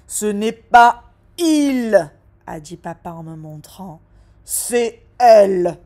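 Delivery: slow, loud, weighted words; a dad correcting, slow and loud; firm correction. Acted. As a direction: spell it out slowly to her.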